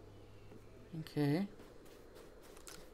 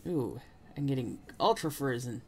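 normal talking voice

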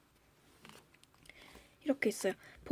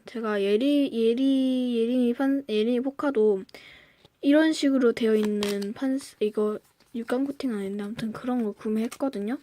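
ordinary talk

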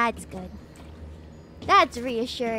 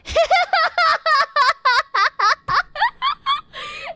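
high, loud peals of laughter; helpless high-pitched laughing; long laughing fit; 13 semitones higher, 6 dB louder than usual. Real, not acted. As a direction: burst into a long cackling laugh. Bursts out laughing at own flirting.

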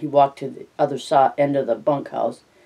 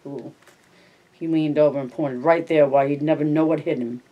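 usual speaking voice

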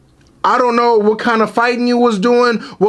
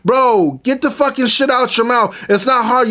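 ordinary delivery

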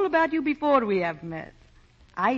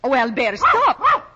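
loud cry, jumping much higher on stop; calling off the dog; 6 semitones higher, 10 dB louder, slower than usual. Acted. Woman cries out loud to stop her dog. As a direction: yelp the command at the dog.

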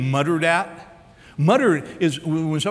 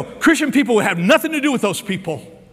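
higher-pitched mock righteous objection; mock disapproval; 6 semitones higher, 3 dB louder than usual. Preacher voices Christians avoiding those people, mock disapproval. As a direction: imitate a churchgoer shunning outsiders. Voicing judgmental churchgoers after "we go.

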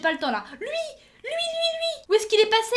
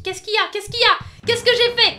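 higher, much louder protest; defensive shout; 7 semitones higher, 10 dB louder than usual. Acted. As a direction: yell back, offended. Panicked defensive yelling at senpai.

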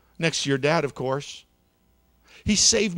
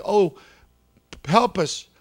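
crying out; 4 semitones higher, 6 dB louder, slower than usual. A narrator's reading. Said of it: "they all started to cry".

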